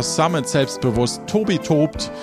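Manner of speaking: normal talking voice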